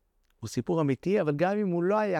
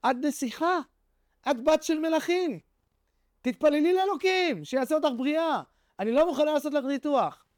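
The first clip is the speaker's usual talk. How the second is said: raised pitch, earnest doctor pleading with her to pray; 8 semitones higher than usual. Retold or imitated. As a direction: lift the voice, gently insistent, like the surgeon. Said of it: "הרופאה אומרת לה".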